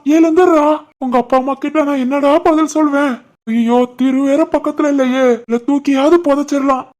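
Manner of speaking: high-pitched fake distress; pretend panic. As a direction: fret helplessly, overacting. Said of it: "எழுப்புற மாதிரி பதட்டமா இருடா". (பதறிப் புலம்புவது போன்ற நடிப்பு)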